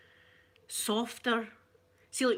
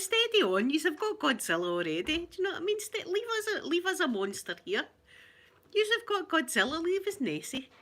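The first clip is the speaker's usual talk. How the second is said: much higher, playful indignant plea; mock protest; 9 semitones higher than usual. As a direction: plead for the monster, half joking. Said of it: "I thought".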